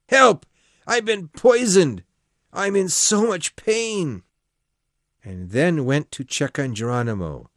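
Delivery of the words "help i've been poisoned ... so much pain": high, loud cry of pain, slower at the end; yelling for help. A narrator's reading. Faking poisoning to the house, loud pained yell. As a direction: yell out like you've been poisoned. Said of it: "and then he yelled".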